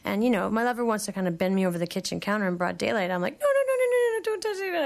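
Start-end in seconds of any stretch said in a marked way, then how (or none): 3.4-4.8 rapid-fire high squeal of no's; mock panic, pitch shooting way up; panicked refusal. Acting out refusing lover's touch.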